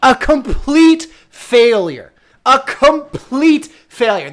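loud, slow, stressed exasperation; exasperated emphasis. Real, not acted. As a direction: hammer each word home. Exasperated verdict on the book.

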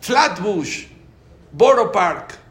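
loud, high named places; preacher's shouted place names; imitated shout. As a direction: shout them like a fiery sermon. Imitating rabbi shouting on mic.

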